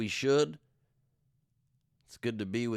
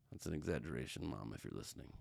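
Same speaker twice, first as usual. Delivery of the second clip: aside to mom; 8 semitones lower, 11 dB quieter than usual. Real, not acted.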